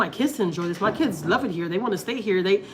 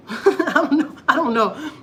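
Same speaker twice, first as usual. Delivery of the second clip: laughing; 7 semitones higher, 4 dB louder, slower than usual. Real, not acted. Laughing at her anxiety.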